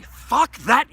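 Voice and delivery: loud, high burst; sudden loud cry; loud fuck that. Acted. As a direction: bark it out in defiance. Urging anger.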